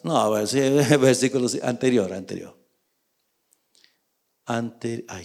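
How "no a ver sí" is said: ordinary talk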